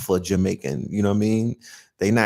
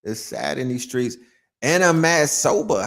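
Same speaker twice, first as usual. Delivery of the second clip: ordinary delivery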